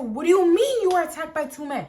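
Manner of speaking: high, loud, incredulous question; shocked disbelief. Retold or imitated. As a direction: relive the shock, voice jumping up. Acts out her shocked reply on phone.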